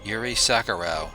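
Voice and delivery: ordinary delivery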